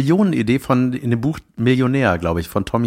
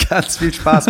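laughing; 6 semitones higher, slower than usual. Pretend. Joins the mock greeting.

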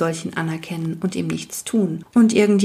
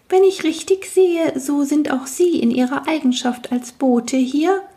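very high, squeaky little voice; piping tiny man; 8 semitones higher than usual. Acted. Tiny man asks the newcomer if he's a messenger, high piping voice. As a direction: pipe it up like a tiny creature.

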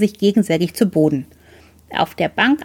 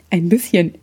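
mocking imitation; 3 semitones lower than usual. A narrator's reading. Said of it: "äffte mich ein Junge mit Zahnlücke nach".

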